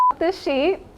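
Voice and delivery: retold flash of anger, cut short; mild mock fury at the sheet; pissed off. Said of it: "you done pissed me off so bad".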